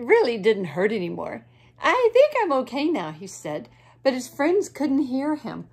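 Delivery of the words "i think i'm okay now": fast bird voice. Acted.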